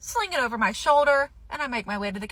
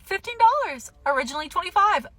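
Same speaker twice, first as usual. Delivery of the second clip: excited bargain; 8 semitones higher, faster than usual. Real, not acted. Bargain discovery.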